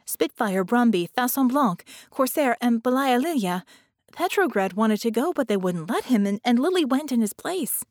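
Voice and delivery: agitated roll call; urgent listing. A narrator's reading. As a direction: rush through the names, upset. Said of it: "Vicky lists lost friends".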